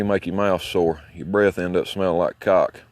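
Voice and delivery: ordinary talk, nothing marked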